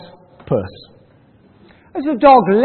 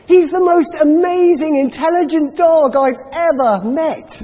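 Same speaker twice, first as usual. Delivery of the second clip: raised, eager praise with a rise on dog; admiring praise; 6 semitones higher, 3 dB louder than usual. Acted. Butcher telling the owner how clever the dog is, eagerly. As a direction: gush lightly about the dog.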